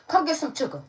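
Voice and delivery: high, loud playful call; calling for kisses. Real, not acted. Reader calling her pet over for kisses, playful.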